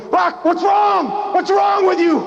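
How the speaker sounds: high, slow shouted coach; Apollo Creed yelling. Retold or imitated. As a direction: yell at him like a trainer. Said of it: "Apollo Creed was going".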